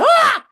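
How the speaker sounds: very high, loud excited holler; holler out the window. Retold or imitated. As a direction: yell out to the news crew below. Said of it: "I'm kinda hollering out the window".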